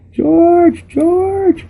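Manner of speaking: mother shrieking for George; shouting. Acted. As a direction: scream his name down the hallway. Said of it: "she shouted".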